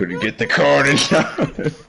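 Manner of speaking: laughing. Real, not acted. Laughing repeat of a line.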